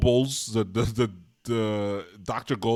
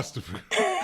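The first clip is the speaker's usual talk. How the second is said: laughing, much higher mock sneer; sneering Nazi officer quote. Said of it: "they talk to her".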